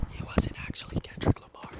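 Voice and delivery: real whisper, no voice at all; hushed correction on the side; whispered aside. Real, not acted. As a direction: drop into a confidential whisper for the viewer.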